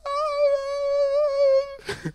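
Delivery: high wailing cry, imitated. Imitating the man on the floor, high wail. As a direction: mimic the man's sobbing wail.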